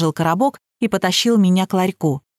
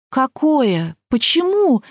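surprised; 5 semitones higher, much slower than usual. A narrator's reading. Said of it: "одновременно спросили мы с Димоном".

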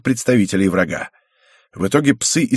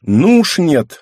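stubborn grumble, higher and louder; sulky teen mumble; 6 semitones higher, 6 dB louder, much slower than usual. Acted. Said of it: "буркнула девчонка".

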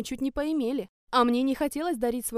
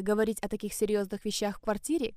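ordinary delivery

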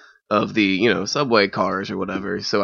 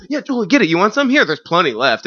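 very high, bright eager offering; eager friendly offer; 9 semitones higher than usual. Retold or imitated. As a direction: push the bottle on her gladly. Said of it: "first instincts was".

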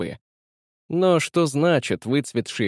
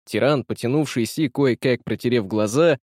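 ordinary delivery, nothing marked

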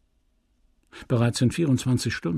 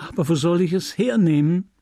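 higher-pitched, pleading question; mildly distraught woman; desperate; 7 semitones higher, 3 dB louder than usual. A narrator's reading. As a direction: plead at a loss, wringing it out. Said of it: "sagte Emma händeringend".

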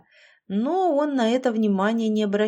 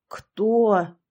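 sharp, louder startled question; old man cries out in shock; alarmed exclamation; 5 dB louder than usual. A narrator's reading. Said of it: "воскликнул дядя Лёша".

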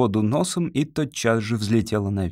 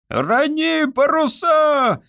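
very high, loud, slow storm yell; shouting; 4 dB louder, much slower than usual. A narrator's reading. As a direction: bellow it over the gale. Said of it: "крикнул он Олафу".

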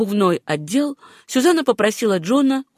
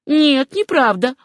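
high, drawn-out protest; exclaiming; 7 semitones higher, 4 dB louder, slower than usual. A narrator's reading. Etta answering the fat man's teasing, exclaiming. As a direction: exclaim it in denial. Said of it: "воскликнула Этта".

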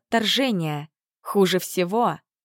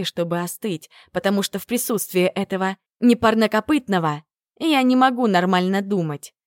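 usual speaking voice

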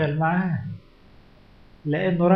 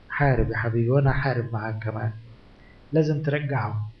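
tender encouragement; 7 semitones lower than usual. Acted. God encourages the listener.

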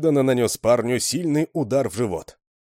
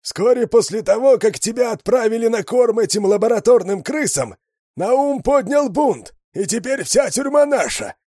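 much higher, loud hoarse voice over the radio; raspy radio voice; 14 semitones higher, 7 dB louder than usual. A narrator's reading. Kruger answers over the radio, loud and hoarse. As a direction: crackle it like a hoarse con on the radio. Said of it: "Сиплый голос".

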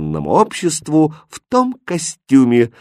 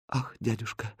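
very soft dying whisper; weak, hushed last words; 13 dB quieter than usual. A narrator's reading. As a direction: whisper it faintly, fading away. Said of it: "прошептала".

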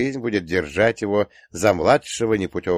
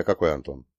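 plain reading voice

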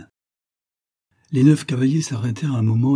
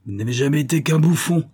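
higher-pitched angry rebuke from an emperor; furious rough outburst; 6 semitones higher than usual. A narrator's reading. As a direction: lash out, temper lost. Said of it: "de cette voix rugueuse et rauque". (colère rugueuse de l'empereur)

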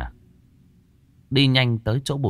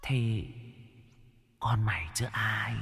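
ghostly whisper; 11 dB quieter than usual. Acted.

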